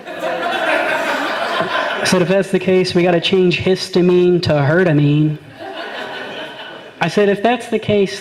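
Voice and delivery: laughing through the punchline. Real, not acted. Building the herstory wordplay joke while laughing. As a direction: crack yourself up as the joke grows.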